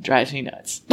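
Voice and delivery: ordinary delivery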